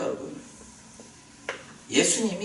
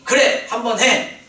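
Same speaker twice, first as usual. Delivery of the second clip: slow emphatic urging; 6 semitones higher, 7 dB louder, slower than usual. Retold or imitated. Lord saying go ahead.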